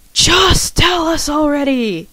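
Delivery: hammed-up viewers demanding he get on with it; impatient viewers. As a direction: play a fed-up audience calling out. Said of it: "you're probably thinking".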